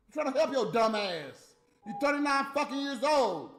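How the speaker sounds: loud, exasperated scolding; frustrated yelling at Natalie; angry rant. Real, not acted. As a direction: tear into her out of frustration.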